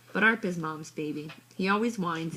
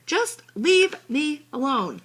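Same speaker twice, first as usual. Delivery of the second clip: higher, louder, slow and pointed; angry outburst; 10 semitones higher, 9 dB louder, slower than usual. A narrator's reading. Megan lashing out at Mom after a lecture, slow angry outburst. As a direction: throw the words back at her.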